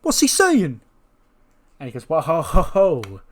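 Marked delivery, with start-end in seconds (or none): 0.0-0.8 high, loud, heated demand; angry confrontation. Acted. Jay gets in Jacob's face, shouting angrily. Jay's getting in his face going. 2.1-3.2 raised, put-on taunting laugh; mocking stage chuckle. Seth tells Jay to relax, mock chuckling.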